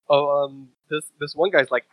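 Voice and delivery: usual speaking voice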